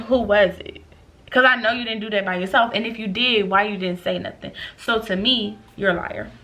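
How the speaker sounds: normal talking voice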